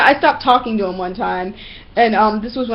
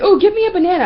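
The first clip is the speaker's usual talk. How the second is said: higher-pitched pestering imitation; mock begging; 9 semitones higher, 4 dB louder, faster than usual. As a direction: imitate someone pestering for a treat. Imitates man begging for banana.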